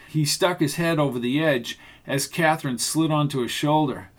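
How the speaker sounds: ordinary delivery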